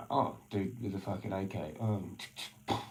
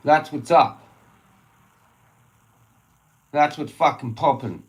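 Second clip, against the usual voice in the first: high, loud raging tirade; angry shouting rant; 10 semitones higher, 14 dB louder than usual. Real, not acted. Ranting at the locals about selling houses, angry shout. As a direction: keep shouting it out.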